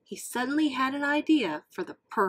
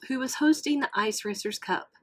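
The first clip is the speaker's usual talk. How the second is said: plain reading voice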